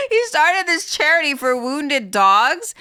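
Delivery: bursting into real laughter, then talking through it slowly; laughing. Real, not acted. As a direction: laugh hard at the absurdity, then slow words still laughing. Laughing at a scummy grift.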